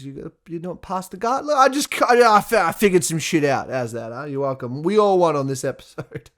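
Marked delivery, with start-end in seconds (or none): none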